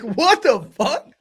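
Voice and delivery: high, loud, drawn-out bewilderment with a laugh; slow mock-shocked exclamation; retold startled disbelief. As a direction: act out being thrown, laughing. Retelling his startled reaction.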